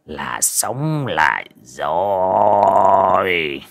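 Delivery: very slow, drawn-out triumphant croon; elated shaman savoring the words; gloating delight. A narrator's reading. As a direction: stretch every word with relish. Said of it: "sướng híp cả mắt".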